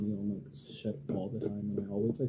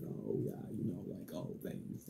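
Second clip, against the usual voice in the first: low, soft, breathy deadpan thanks; sarcastic; 4 semitones lower, 9 dB quieter than usual. Retold or imitated. Boyfriend sarcastically thanking her for a compliment, low and dry. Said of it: "that sarcastic flow".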